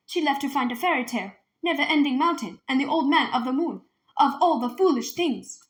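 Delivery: loud, scornful outburst; scornful anger. A narrator's reading. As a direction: sneer at the tales. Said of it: "her words cracking the air".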